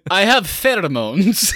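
high, slow, loud mock British-Russian accent; Ray Winstone's muddled accent. Podcaster mocking the villain's accent, muddled British-Russian. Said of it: "doing a British or Russian accent".

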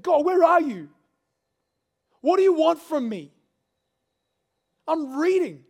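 high, loud, raw pleading; desperate cry to God. Retold or imitated. Pastor alone on the beach at night, loud desperate pleading. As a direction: call out to God like nobody's answering.

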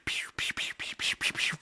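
breathy whispered pew pew shots; soft mouth-made finger-gun shots; whispered gunfire. Retold or imitated. Snake and the old man finger-gunning each other, whispered pews.

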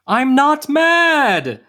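high, loud, drawn-out shout; angry yell. Acted. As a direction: yell it in exasperated denial. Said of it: "didn't mean to yell at you".

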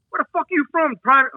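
imitating a drill sergeant grilling a private; drill sergeant bark. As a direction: sneer it down at the recruit. Drill sergeant questioning recruit.

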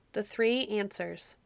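plain reading voice

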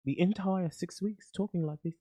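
London accent with unhurried, careful words; put-on London accent. Shown as a demonstration. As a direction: pass yourself off as a Londoner. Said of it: "thought we were from London".

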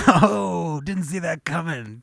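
imitating a delighted onlooker, high and loud; big high "oh", relishing the twist; genuinely entertained. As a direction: burst out with mock amused surprise. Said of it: "he's genuinely entertained by this".

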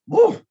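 loud high whoosh; mock rocket sound. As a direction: imitate anger shooting up. Anger rising story.

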